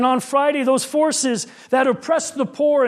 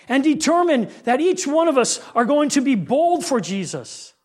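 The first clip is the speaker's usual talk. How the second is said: preaching. Acted. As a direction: urge the church to boldness. Closing exhortation.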